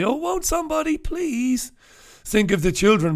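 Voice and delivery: shrill, high mock-fretful wail; Helen Lovejoy. Host mocking hand-wringing with a high Helen Lovejoy wail. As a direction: wring your hands like a pearl-clutching busybody. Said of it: "I'm not going to do the Helen Lovejoy".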